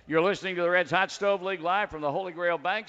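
booming on-air station read; radio announcer. Acted. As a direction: announce the show proudly.

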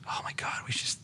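hushed excited whisper. Retold or imitated. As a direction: whisper it like a secret. Retold excited idea, whispered.

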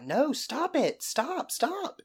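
high, acted-out cry of protest; retold pleading refusal. Storyteller voices bride refusing drunk fiancé, high mock plea.

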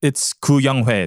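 put-on slow, deliberate "Koo Young Hoi", Koo stressed; correct pronunciation. Shown as a demonstration. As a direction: spell out "Koo Young Hoi" slowly for the record. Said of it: "How do you pronounce it".

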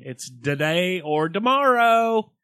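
slow, loud put-on Australian accent; mock Australian accent. Joking about Australian "today" in a slow mock Australian accent. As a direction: stretch it out in a hammy Aussie voice. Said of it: "friends in New Zealand and Australia".

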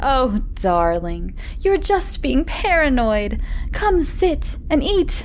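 wife's voice. Acted. Wife coaxing Michael to dinner, high sweet voice. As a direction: coax him, sweet and slow.

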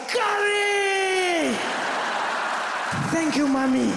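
high, long-held cry of delight; ecstatic scream. Retold or imitated. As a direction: scream it out in rapture, then shout thanks. Echoes friend's scream, now delighted.

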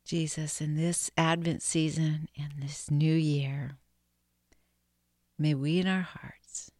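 slow whispered prayer; slow breath prayer. Real, not acted. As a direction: breathe each phrase slowly. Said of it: "let's just pray this breath prayer together now".

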